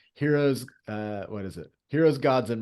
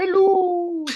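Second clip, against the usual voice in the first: squeaky hello; 6 dB louder than usual. Pretend. High squeal mid-chat.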